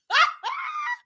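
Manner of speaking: high shrieking laugh. Real, not acted. Big laughing shout.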